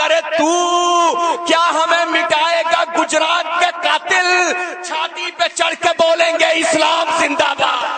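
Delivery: high, loud, slow defiant roar; impassioned shouted verse. Acted. Poet defying the enemy before the cheering crowd, high slow shout. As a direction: fling the challenge at the enemy.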